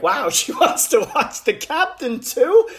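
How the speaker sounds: high, loud, slowed amazed laugh-through; hammed-up happy astonishment; delighted disbelief. Delighted at wife's interest.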